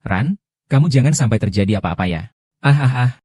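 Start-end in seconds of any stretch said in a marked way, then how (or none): 2.6-3.1 labour groans. Acted. Woman in labour groaning through contractions. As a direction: push through the pain with short groans.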